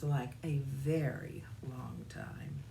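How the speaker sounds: slow, drawn-out whisper. A narrator's reading. Narrator describing the long dark wait, slow whisper. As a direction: stretch it slowly in a whisper.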